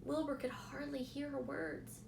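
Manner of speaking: plain reading voice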